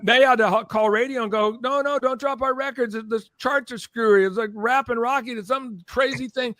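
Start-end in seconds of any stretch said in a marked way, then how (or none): 1.6-6.5 high-pitched imitation of panicked callers; frantic pleading. Labels beg radio not to drop records, frantic mock pleading. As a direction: plead urgently, acting out the desperate label reps.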